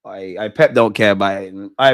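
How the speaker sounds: ordinary delivery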